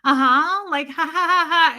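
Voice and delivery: forced breezy "ha, ha, ha" to hide panic; acting unbothered on air with a fake laugh; fake casual laugh. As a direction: play it cool with a hollow laugh. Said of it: "having to play cool with Tommy".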